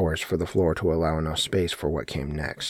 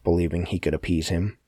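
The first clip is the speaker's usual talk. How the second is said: plain reading voice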